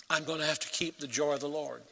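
ordinary delivery

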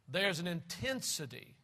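normal talking voice

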